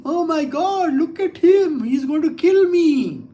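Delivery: high-pitched mock panic; hammed-up cry for help, teasing; feigned terror. Lord pretending the demon will kill him, feigned terror. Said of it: "It's a feigned way".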